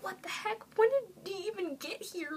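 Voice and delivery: squeaky high surprised cry, voice jumping up; shocked disbelief. Retold or imitated. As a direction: gasp it out like you just saw your idol appear. Spots the singer suddenly behind her.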